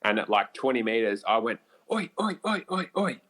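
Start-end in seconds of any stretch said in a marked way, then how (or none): 1.9-3.2 high pitched mock yell at the elk; retold yell at a charging elk. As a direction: call out oi to stop him. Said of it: "and yelled out".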